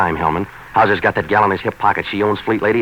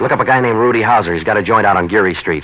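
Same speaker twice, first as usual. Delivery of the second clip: loud, insistent tip-off; forceful push; 5 semitones higher, 6 dB louder than usual. Acted. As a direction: raise the voice and press the cop hard. Pushing Hellman to follow a lead.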